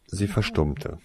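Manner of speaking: usual speaking voice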